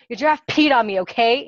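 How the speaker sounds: loud, exasperated outburst; shouted. A narrator's reading. Coworker repeats mishap to boss, shouting. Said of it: "Andy shouted".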